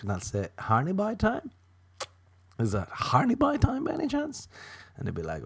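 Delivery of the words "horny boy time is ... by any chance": very high, squeaky-pitched question; silly hopeful asking. Acted. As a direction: ask coyly in a daft falsetto. Silly voice answering own brain.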